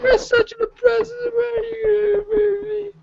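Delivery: very high squealing whine; a hammed-up wail; mock whine. Joining the mock fight, a very high whining wail. Mock argument over who is oppressed.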